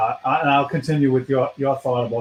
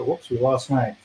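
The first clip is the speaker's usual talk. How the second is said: ordinary talk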